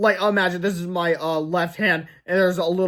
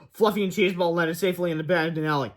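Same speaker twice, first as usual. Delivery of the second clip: ordinary delivery, nothing marked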